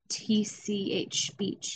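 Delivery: slow trailing whisper. Real, not acted. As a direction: fade the letters into a whisper.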